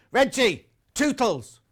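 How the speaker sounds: loud, high call across the room; shout out the dog's name. Acted. Host calls out to the viewer's dog as asked, loud and high. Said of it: "can you shout out Tootles".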